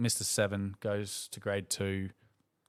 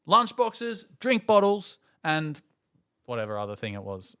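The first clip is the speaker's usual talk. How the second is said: high, loud barked orders; imitating a strict teacher; 9 semitones higher, 5 dB louder than usual. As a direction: order the kids into lines. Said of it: "The teacher was quite strict".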